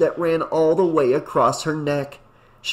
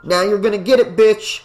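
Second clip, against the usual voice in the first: annoyed threat; 6 semitones higher, 4 dB louder than usual. A narrator's reading. Annoyed at prank.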